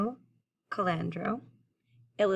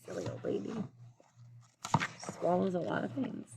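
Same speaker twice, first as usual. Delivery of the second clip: very soft, half-whispered aside; hushed aside; 6 dB quieter than usual. Real, not acted. Reader commenting to children on silly old lady, hushed aside. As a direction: drop to a near whisper for a side comment.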